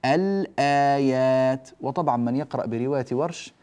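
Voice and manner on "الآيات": الآيات slowly with the ل fully sounded; careful teacher's model of الآيات; clear lam demo. Shown as a demonstration. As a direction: stretch الآيات, holding ل and long vowels. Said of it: "فنقول".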